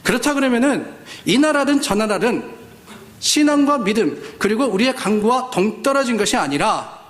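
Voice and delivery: ordinary delivery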